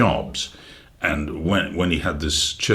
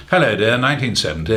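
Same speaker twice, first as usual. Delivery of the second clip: put-on old BBC voice; 5 semitones higher, 3 dB louder, faster than usual. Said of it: "he sounded incredibly sort of BBC thirties".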